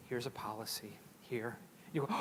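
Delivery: a hint of an administrator passing a memo; mock administrator. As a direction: hand it over flatly like a dull official. Administrator handing over a policy.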